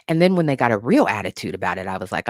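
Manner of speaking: ordinary talk, nothing marked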